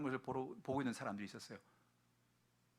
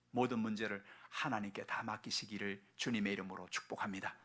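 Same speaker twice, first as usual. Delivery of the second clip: ordinary talk, nothing marked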